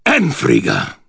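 high snarled incantation; snarled spell. A narrator's reading. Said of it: "and snarled".

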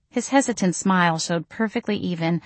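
ordinary delivery

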